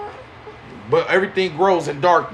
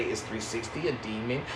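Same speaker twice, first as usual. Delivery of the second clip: low, much softer spooky documentary voice; mock spooky narrator; 7 semitones lower, 13 dB quieter than usual. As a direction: mimic a scary TV narrator, hushed and ominous. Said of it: "put some scary music behind it and say".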